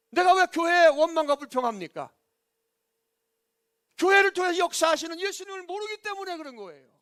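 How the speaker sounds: loud, high, forceful question and answer; full-force preaching. Real, not acted. Preacher challenging complaints against the church, full-force preaching. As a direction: cry it out to the whole church.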